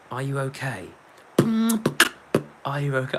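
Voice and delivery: mum. Retold or imitated. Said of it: "I guess she'd be like".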